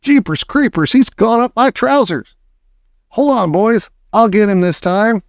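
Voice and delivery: high, startled character shout. A narrator's reading. Cook with mouse up his trousers, shouted to mates. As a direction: call to the others, pitch well up. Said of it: "the cook shouted".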